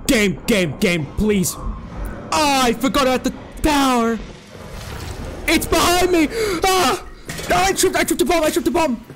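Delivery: panicked high screams while fleeing; frantic, terrified yelling; being chased. Real, not acted. Monster chasing in horror game.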